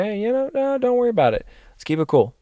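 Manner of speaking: high, light and easygoing; calming de-escalation. Retold or imitated. Calming down a stranger who insulted his wife, easygoing. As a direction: soothe the tension, keep it friendly. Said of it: "to make sure".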